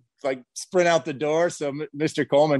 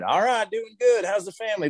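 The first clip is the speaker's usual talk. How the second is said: higher, mock-jolly reply; jolly old man; 9 semitones higher than usual. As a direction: ham up the cheerful older man. Coleman's jolly reply retold.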